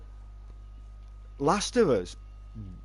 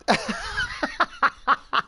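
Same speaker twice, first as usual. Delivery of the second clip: very high, helpless shriek of laughter. Real, not acted. Streamer losing it at his own joke, shrieking laugh. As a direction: let the laugh break up into a squeal. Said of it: "I'm having a bit of a moment".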